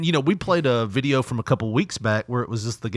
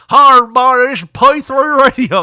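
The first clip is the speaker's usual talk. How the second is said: self-mocking Southern twang, much louder; exaggerated Southern accent; 10 semitones higher, 10 dB louder than usual. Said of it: "that's Southern accent".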